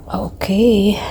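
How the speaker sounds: breathy whispered okay. Real, not acted. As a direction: whisper it so nobody wakes. Whispering to the camera.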